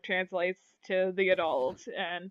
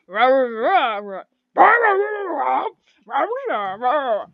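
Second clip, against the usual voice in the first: loud roaring orc gibberish; swooping, rolling orc babble; half-orc speech; 5 semitones higher, 6 dB louder than usual. Acted. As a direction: argue in growled nonsense syllables. Half orc answers in orc language.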